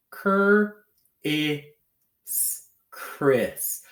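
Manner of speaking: Cur-is in high, separate sounds, then blended Chris; slow sounding out. Shown as a demonstration. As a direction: break Cur-is into sounds and join them as Chris. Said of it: "say it slowly".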